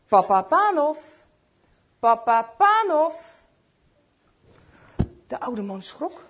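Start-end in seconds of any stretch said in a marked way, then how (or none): 0.1-3.4 calling out. Acted. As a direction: call through the room to wake him. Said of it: "klonk er een stem door de kamer". (roepende stem, tweede keer langzamer)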